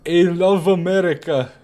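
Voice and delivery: put-on slow, high, loud German-accented line; mock German soldier accent; thick German accent. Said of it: "German soldier saying in his thick accent".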